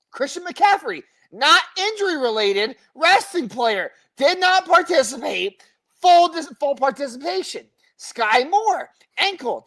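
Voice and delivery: high-pitched, emphatic list reading; injury roll call. Acted. Host reeling off the injury report to co-host, emphatic roll call. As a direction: punch each player's name high, let the status drop.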